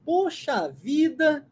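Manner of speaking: drawn-out, high exclamation of amazement; slow, astonished exclamation; surprised. Shown as a demonstration. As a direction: stretch the words out in surprise. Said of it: "num sinal de espanto, né, de surpresa".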